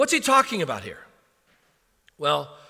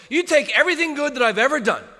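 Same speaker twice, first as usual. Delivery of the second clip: higher, fast, animated Paul listing achievements; acting as Paul; 7 semitones higher, 3 dB louder than usual. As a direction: rattle it off as Paul, emphatic. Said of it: "Paul says".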